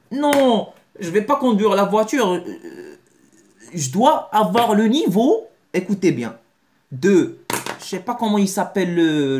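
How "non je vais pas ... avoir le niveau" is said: high, fussy voice of a learner refusing to drive; high-pitched excuse-making voice; mock timid learner. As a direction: play the nervous beginner, a bit hammed-up.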